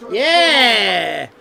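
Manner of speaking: loud cheer. Real, not acted. Streamer cheering after crooning, loud whoop.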